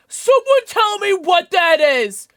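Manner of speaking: high, loud, slow shout of alarm; startled shout. Real, not acted. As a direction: yell it out in real panic. Startled by an unknown mob.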